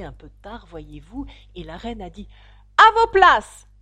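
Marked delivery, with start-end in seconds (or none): 2.8-3.7 high, very loud shouted order; thunderous. A narrator's reading. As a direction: roar the command like an angry queen. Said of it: "d'une voix de tonnerre". (voix de tonnerre)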